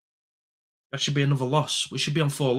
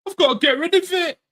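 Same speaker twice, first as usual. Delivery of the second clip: much higher, louder panicked squeal; hammed-up panicking midfielder; mock panic; 7 dB louder than usual. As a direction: squeak it out like a player in a blind panic. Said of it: "it's no".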